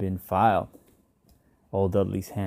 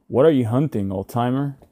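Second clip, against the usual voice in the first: amused, raised and a bit louder, half mocking; laughing sneer; 4 semitones higher, 6 dB louder than usual. A narrator's reading. Neighbour teasing the old man on the stairs, amused sneer. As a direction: tease him like a grinning stranger.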